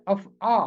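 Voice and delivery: अफ़आ ending in a long open "aa" after a break; careful af-AA for अफ़आ; original pronunciation. Shown as a demonstration. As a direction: say अफ़आ as af-aa, two clear syllables. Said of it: "हकीकत में इसका तलफ़ुज़ है".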